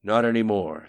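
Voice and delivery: loud, forceful retort with mild venom; spat out. A narrator's reading. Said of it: "spat the voice after a brief silence".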